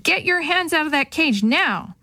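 mild narrated shout; restrained shouted order. A narrator's reading. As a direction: order someone away from danger, storyteller-style. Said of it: "a voice shouted".

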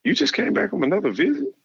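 high-pitched mock coach voice, friendly; imitating the coach. Coach phoning a recruit late at night, high mock coach voice. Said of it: "He said".

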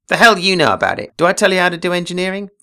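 a read-aloud flare of temper; angry outburst. Acted. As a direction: snap back, offended and fast. Derek flares up angrily.

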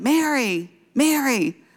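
mock yell, high and drawn out; imitated calling out. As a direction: imitate a friend calling through the house. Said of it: "she's yelling".

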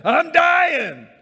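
loud high mock-desperate shout; panicked disciple. Disciple in the sinking boat, hammed-up panicked shout. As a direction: yell it like you're going under.

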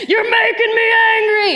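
very high, loud toy-monster shout; Hulk toy shout. Retold or imitated. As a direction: blast it like the toy going off at the slightest touch. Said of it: "stuffed animal Hulk toy would go".

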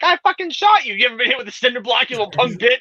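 high mock kid voice; imitated kids. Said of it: "'cause they're like".

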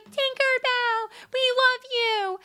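high, slow sweet chorus; mock girly gush. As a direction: coo it lovingly. Friends gushing.

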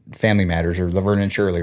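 ordinary delivery

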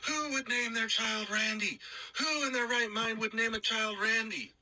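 put-on high, drawn-out, incredulous Irish accent. Roommate can't believe a child is named Randy, incredulous Irish accent. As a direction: imitate a baffled Irishman, high and slow. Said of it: "pretty good job with that accent".

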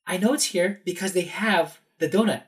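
high, emphatic arguing; insistent protest. Retold or imitated. Visitor argues with an employee over a photo, insistent. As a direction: insist on it as if presenting proof.

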